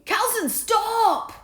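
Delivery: loud, high, slow shout. A narrator's reading. Bartholomew shouting after Carlson, loud. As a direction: stop him in his tracks. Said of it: "shouted Bartholomew".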